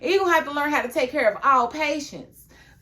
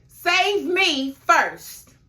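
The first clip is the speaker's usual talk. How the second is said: each word slow and heavy: Save me first; drawn-out demand; 5 semitones higher, 3 dB louder, much slower than usual. Pretend. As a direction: spell out Save me first like a selfish order. Mother's selfish demand, drawn out.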